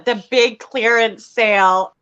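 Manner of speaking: ordinary talk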